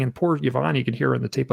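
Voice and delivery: ordinary talk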